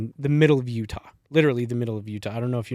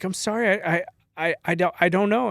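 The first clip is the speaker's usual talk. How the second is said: higher-pitched, stammering apology; mock-apologetic, stumbling and high; flustered apology; 7 semitones higher than usual. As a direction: imitate a flustered woman apologizing. Flustered kitchen lady retold apologizing.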